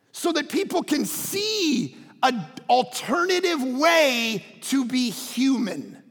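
high, emphatic preached prayer, key words stretched; Jesus. Acted. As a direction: preach the plea, drawing out the big phrases. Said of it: "Jesus said in John seventeen".

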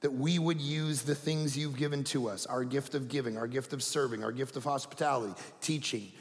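soft, low prayerful voice. Acted. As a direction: pray quietly and earnestly. Pastor prays.

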